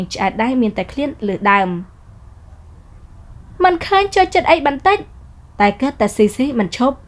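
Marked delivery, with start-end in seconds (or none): 3.6-5.0 higher, urgent stop-it; high young woman's hushed scold. Acted. Sarah cutting off the teasing man, high embarrassed scold. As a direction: cut him off, embarrassed.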